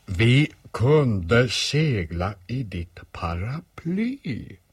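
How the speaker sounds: very slow, every word drawn out; slow emphatic repeat. Acted. Bear repeats idea until boy understands, slow and emphatic. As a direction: spell out the idea slowly, word by word.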